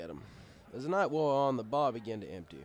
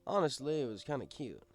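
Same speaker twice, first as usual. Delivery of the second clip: ordinary delivery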